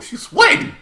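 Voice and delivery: sudden high, loud exclamation; surprised squeal. Real, not acted. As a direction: squeal it in disbelief. Surprised by the screen.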